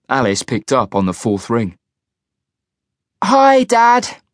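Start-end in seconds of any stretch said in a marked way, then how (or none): 3.2-4.2 put-on high, bright young girl greeting; cheerful girl. A narrator's reading. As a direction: chirp it like a happy daughter. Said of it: "she said cheerily".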